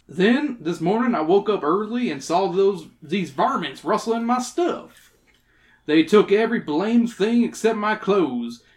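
put-on folksy old prospector telling his tale. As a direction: spin it out like a rustic old-timer. Reads old prospector's dialogue.

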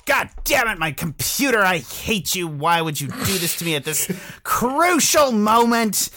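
mock rage at computer. Real, not acted. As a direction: scold the computer in playful fury. Yelling at frozen computer.

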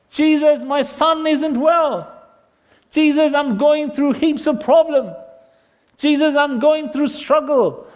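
high, sustained cries for help; urgent pleading. Acted. Desperate people beg Jesus for help, urgent pleading. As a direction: call out pleadingly, pitched well up.

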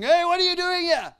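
high, loud, fast gangster yell; mock thug shout. Preacher retelling crime lords confronting him, mock thug shout. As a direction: bark it like a threatening thug.

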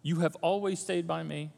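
soft, gentle reassurance; father comforting his older son, tender. Acted. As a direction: speak softly to your hurt son. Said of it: "it was".

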